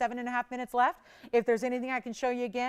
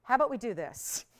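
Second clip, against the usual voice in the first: usual speaking voice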